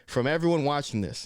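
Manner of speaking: normal talking voice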